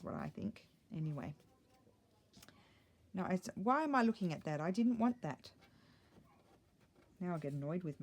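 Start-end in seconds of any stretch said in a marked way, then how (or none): none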